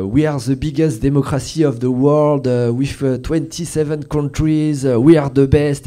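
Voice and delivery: mock a French official boasting in strongly French-accented English; hammed-up French accent on English words; Thierry Breton's French accent. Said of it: "qui commence en fait avec un accent très français".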